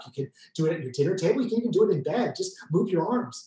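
normal talking voice

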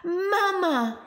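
put-on girl shouting to her mother in joy. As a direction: call out across the snow. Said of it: "Irene shouted".

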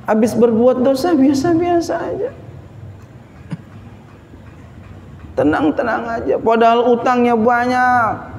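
high, impassioned sermon delivery; preaching. Real, not acted. Preaches at raised pitch. (khotbah berapi-api, nada tinggi)

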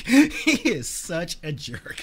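real laugh through the words, slow. Real, not acted. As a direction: laugh out the complaint. Laughing at Batman's move.

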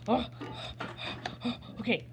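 ordinary delivery

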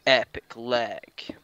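slow, drawn-out whisper; hushed aside. Pretend. Gamer commenting on the leg, slow whisper. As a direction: stretch the words out in a whisper.

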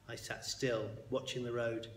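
ordinary delivery, nothing marked

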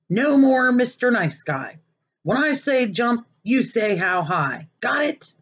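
high, laying down the law; stern fed-up guardian. Acted. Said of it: "he told them".

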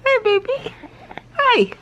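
high playful tickle sing-song; giggly tickle attack. Real, not acted.

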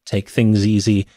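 normal talking voice

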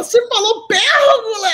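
very high mock cry of disbelief; esposa histérica. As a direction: imitate a hysterical wife accusing her husband. Wife insisting hysterically on pérgola. (imitação aguda de esposa incrédula)

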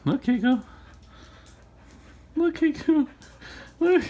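high-pitched pet call; calling the dog. Real, not acted. Owner calling dog to look, high coaxing. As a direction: call a pet's attention, high and playful.

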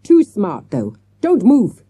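loud, high shriek of fury. A narrator's reading. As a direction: snap at them, rising to a loud shriek. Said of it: "she shrieked".

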